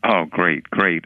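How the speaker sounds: ordinary talk, nothing marked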